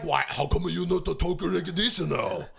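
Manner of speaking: put-on slow, caricatured Japanese accent; hammed-up fake Japanese delivery; mock Japanese accent. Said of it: "he went to Japan for two years".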